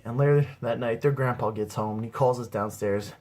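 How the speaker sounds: usual speaking voice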